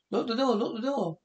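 high, urgent plea to lock up; panicked order. Acted. Hiding from the monster kid, panicked urgent order.

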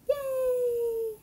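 high long cheer; cheering yay. Acted. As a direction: cheer with delight. Animals cheer rescue.

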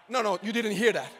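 ordinary talk

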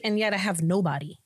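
ordinary talk

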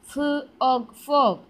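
ഫ്, ഓഗ്, then blended ഫോഗ്; sounding out. Shown as a demonstration. Teacher reading back the written word, sounding out ഫോഗ്. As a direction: split ഫോഗ് into two sounds, then join loudly. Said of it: "sound പറഞ്ഞ് പഠിക്കുന്നതാണ്".

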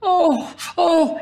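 high rapturous oh; a crowd's delighted cry; cried in raptures. A narrator's reading. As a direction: gasp at the burst of the fireworks. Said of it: "And then everyone cried".

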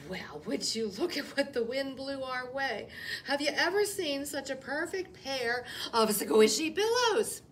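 loud, bright gushing; mock delight. Rabbit pretends bunnies are pillows, loud gushing. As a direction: gush over pretend pillows.

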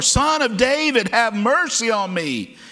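high, loud desperate plea; insistent full-voiced yell, pitched high. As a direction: shout for mercy, refusing to be silenced. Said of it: "he cried out all the more".

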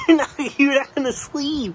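high, insistent whine; high-pitched protest; nagging dad awake. Real, not acted. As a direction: pester a dozing parent, pitched high. Nagging dad who keeps dozing.

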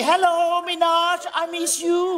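high, stretched-out hello; showy greeting. Acted. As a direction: greet everyone with drawn-out flamboyance. Showy greeting to crowd and girlfriend. (chào hỏi cao giọng, kéo dài)

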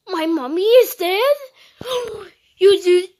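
loud, gasping disbelief; hammed-up horrified question; acted shock. Acted shock at mother's death.

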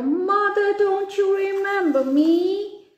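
high pleading little bird; tiny sparrow voice. Acted.

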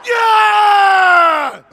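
long, high, full-force shout; huge sustained hype shout; trademark yell. Shown as a demonstration. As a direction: let rip one drawn-out yell. Delivers his trademark yell.

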